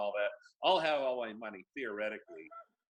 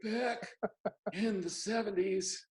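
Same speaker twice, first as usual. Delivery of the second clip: put-on high thin old man voice; 12 semitones higher, slower than usual. Shown as a demonstration. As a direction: play a doddering old timer. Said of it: "I have to do the old man voice now".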